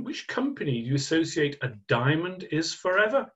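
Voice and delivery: ordinary talk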